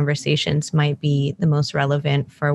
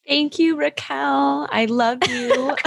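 gushing; 10 semitones higher, slower than usual. Real, not acted. Thanking a listener's kind review.

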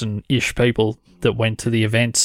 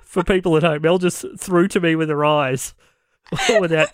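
higher, talking through a laughing fit; real helpless laughter; 6 semitones higher than usual. Real, not acted. Host explains the silent handover to listeners, laughing helplessly. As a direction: try to explain while cracking up. Host breaking up over co-host's look.

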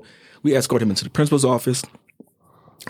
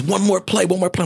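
put-on pleading kid; 9 semitones higher than usual. Angry kid begging for more.

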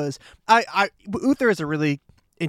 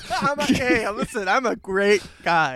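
put-on New York accent; 6 semitones higher than usual. Co-host mimicking the friend's New York bit, mock New York accent. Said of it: "if you want me to do a New York accent".

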